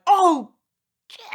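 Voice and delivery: high, loud retold alarm; sudden mock panic; startled curse. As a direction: react to the boulder with a jolt. Seeing boulder in road.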